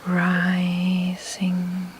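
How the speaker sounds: RISING stretched long on a level tone; mental noting. Shown as a demonstration. Meditation teacher showing how to note the breath, slow drawn-out rising. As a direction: draw out each syllable of rising, slowly and evenly. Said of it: "you note in your mind".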